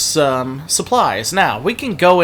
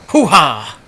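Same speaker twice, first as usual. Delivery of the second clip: put-on louder Johnny Bravo accent exclamation; 4 dB louder than usual. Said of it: "more of akin to Johnny Bravo".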